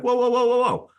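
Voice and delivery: high, quick calls of whoa, half-acted; mock alarmed whoa. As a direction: stop someone walking off, lightly hammed. Colleague walks away mid feedback.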